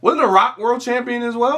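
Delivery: incredulous. Real, not acted. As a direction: ask like you can't believe it. Incredulous challenge about The Rock.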